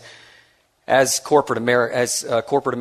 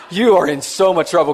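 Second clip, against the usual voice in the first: louder teasing repeat; 5 semitones higher, 4 dB louder than usual. Retold or imitated. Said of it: "he goes".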